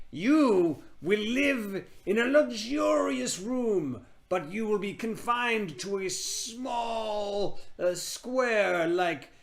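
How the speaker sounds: high, slow, put-on witch voice. A witch pronouncing a curse, high and slow. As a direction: drag it out, high and eerie. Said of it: "pop culture savvy witch".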